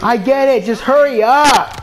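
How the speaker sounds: high, loud impatient yell; real furious yell; angry shout. Real, not acted. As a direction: shout at the screen to hurry. Yelling at game animation.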